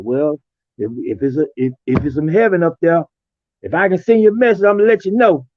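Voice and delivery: a playful mock of the General joking about heaven; joking imitation. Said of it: "we'll start making jokes about it".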